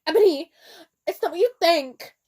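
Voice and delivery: loud, high pleading cry to Ebony; hammed-up desperate yell; mock scream. A narrator's reading. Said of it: "Draco screamed sa-, sadly".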